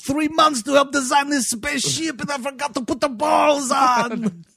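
high, loud, half-sung mock panic; hammed-up shouted lament; mock panicked shout. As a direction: wail it like a hapless engineer. Mocking designer who forgot balls.

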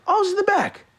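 high, breezy fake innocence; high put-on innocence; innocent cover. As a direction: play it cool and innocent.

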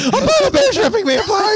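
hammed-up high panicked cry, laughing; mock terror. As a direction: squeal through laughter. Mock Arctic disaster scene.

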